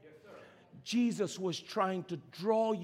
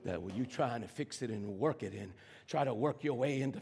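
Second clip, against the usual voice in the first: low, soft, weighty delivery with long pauses; hushed, solemn preaching; 6 semitones lower, 5 dB quieter than usual. Real, not acted. Preacher telling the church they are at peace with God, hushed. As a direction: bring the sermon down to a quiet reflection.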